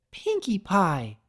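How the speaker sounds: high, slow sharp call of a name; sharp scolding call. A narrator's reading. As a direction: stop her in her tracks. Fluttershy stops Pinkie.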